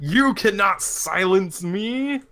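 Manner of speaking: high, slow, drawn-out declaiming; defiant ranting. Acted. Backhanded prisoner refuses to be silenced, defiant. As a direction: proclaim it grandly, stretching each phrase.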